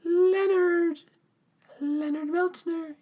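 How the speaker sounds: dazed, very high-pitched mock wail; Eugene. Eugene walks into Wit's End in shock, dazed high wailing. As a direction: draw the name out high, as if in a trance. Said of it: "Eugene's just dazed".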